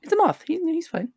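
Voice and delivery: very high, squeaky protest; protective pleading. Real, not acted. Defending the moth from being smashed, high anxious pleading. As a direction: plead for the little guy, high-pitched.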